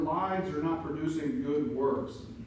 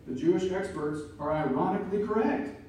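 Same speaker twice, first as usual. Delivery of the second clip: normal talking voice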